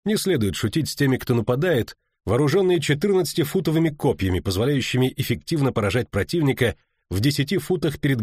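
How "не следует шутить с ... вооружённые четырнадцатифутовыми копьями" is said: ordinary delivery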